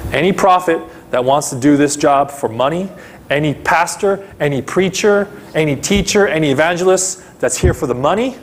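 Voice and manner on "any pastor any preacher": ordinary talk, nothing marked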